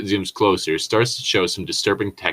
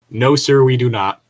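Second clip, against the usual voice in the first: mock loud refusal; 5 semitones higher, 7 dB louder than usual. Mock reply to imagined dealer.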